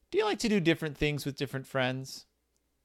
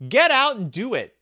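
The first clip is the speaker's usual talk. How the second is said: high, loud heading call-out; loud announcement; 11 semitones higher, 9 dB louder than usual. Acted. Host announcing the book's activity section, loud and high. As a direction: belt it out like a rallying cry.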